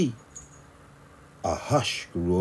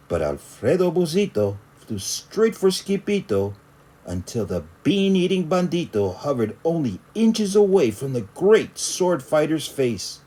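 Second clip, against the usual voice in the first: ordinary delivery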